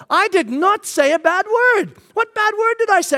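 high-pitched, emphatic comic denial; indignant protest. Retold or imitated. Dad defending himself to his five-year-old, mock-indignant protest. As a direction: protest innocence, voice climbing.

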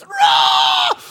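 sudden very high, loud yell; mock pain yell. Adam yelping as God rips his rib. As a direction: yelp like a rib is ripped out.

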